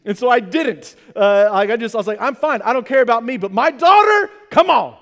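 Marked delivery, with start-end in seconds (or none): 2.2-5.0 pleading papa. Retold or imitated.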